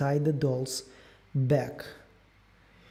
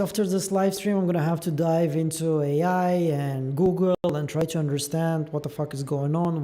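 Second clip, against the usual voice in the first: normal talking voice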